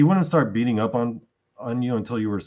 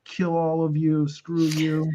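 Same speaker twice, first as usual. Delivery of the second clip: imitated hateful teen outburst, pitched higher; retold screaming; 7 semitones higher than usual. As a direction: spit it down the stairs at the parents, mock fury. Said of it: "screaming down at her parents".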